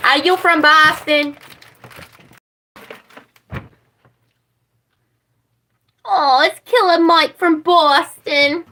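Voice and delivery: mock Boston drawl, slow on Boston; Boston accent. Streamer teasing a Boston viewer with hammed-up Boston accent. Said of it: "after doing that impression".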